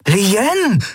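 high, excited call of her name. A narrator's reading. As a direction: cry out the name, pitched high. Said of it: "rief er". (laut rufend)

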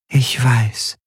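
lightly voiced remorseful spirit; dark-eyed figure. A narrator's reading. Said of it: "sagte die Gestalt mit den schwarzen Augen".

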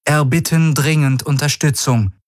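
high-pitched radio plea, acted; urgent comms call. A narrator's reading. Ship's father radioing the fleet for support, urgent. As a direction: call for backup over the comms. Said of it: "rauschte die Stimme von erster Schiffsvater über die Comms".

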